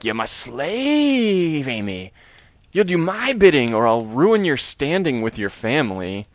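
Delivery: high, slow, drawn-out evil dummy; a hammed-up villain dummy sneering at his slave; creepy Slappy voice. Slappy threatens Amy.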